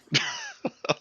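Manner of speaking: short high-pitched laugh; high little burst of laughter. Real, not acted. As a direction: laugh lightly after your own remark. Laughing after own remark.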